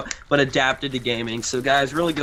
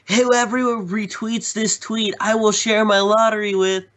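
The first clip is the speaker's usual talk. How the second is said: higher-pitched imitation of a kid's tweet; put-on higher voice, making fun of a tweet; mocking Twitter kid; 7 semitones higher than usual. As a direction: mimic an attention-seeking kid online, pitched up. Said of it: "those kids who go on Twitter".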